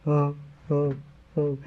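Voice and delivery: imitated drawn-out moaning "oh"s; woe-is-me moaning, put on; mock self-pity. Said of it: "and they go".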